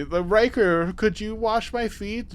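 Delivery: lightly voiced flirtatious request; imitated Irish lady.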